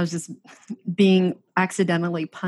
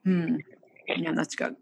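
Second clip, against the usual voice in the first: savoring delicious food; 5 dB quieter than usual. Real, not acted. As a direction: mouth full, softly savoring. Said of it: "I'm gonna eat one bite".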